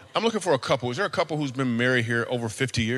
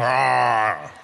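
lion roar. Pretend. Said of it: "Oh, roaring".

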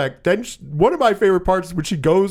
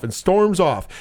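ordinary talk, nothing marked

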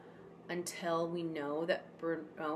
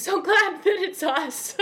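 laughing through the words, much higher and louder; 12 semitones higher, 12 dB louder than usual. Real, not acted. Guest gushing to the host about adopting Bruno, laughing. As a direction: giggle it out, overjoyed.

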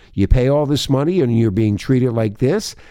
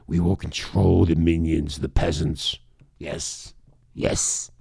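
sinister ruler voice; 7 semitones lower than usual. Pretend. Voicing the power brokers' scheming.

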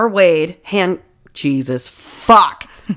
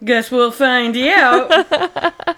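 laughing; 3 semitones higher, much slower than usual. Real, not acted. Laughing repeat.

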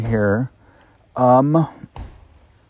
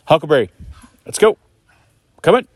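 calling the dog; 7 semitones higher, faster than usual. Real, not acted. Owner calling his dog back from the next camp spot. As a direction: sing out the dog's name to bring him back.

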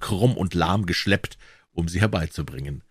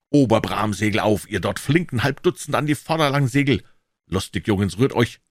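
ordinary delivery

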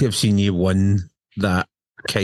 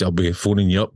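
usual speaking voice

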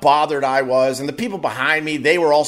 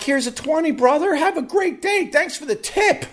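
much higher, chummy thanks; mock cheery thanks; 12 semitones higher than usual. Comic tipping the helpful worker, hammed-up cheery. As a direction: tip him like his best buddy.

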